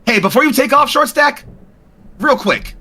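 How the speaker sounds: high, calling out to stop the drone. Acted.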